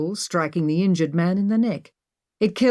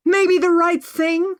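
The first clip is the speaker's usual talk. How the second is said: high, slow shout; shouting back; 13 semitones higher, 5 dB louder, slower than usual. A narrator's reading. Maggie shouting back at Emma. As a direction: hurl it back at her. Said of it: "Maggie shouted back".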